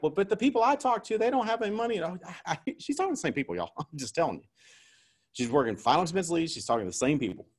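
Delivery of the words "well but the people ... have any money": high, whiny imitation of a doubting agent; mock doubtful agent. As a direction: mimic a rookie making excuses in a high voice. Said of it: "before you think anything like".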